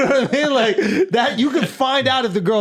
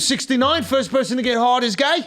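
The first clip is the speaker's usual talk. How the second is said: hammed-up Aussie, a bit higher; mock Australian accent; 5 semitones higher than usual. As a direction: slide into a cheeky Australian accent. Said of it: "I'm going Australian again".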